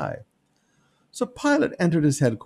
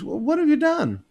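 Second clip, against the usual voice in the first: much higher, baffled question; confused Pilate; 13 semitones higher than usual. Acted. Pilate baffled by Jesus' answer, confused and higher. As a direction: sound honestly puzzled.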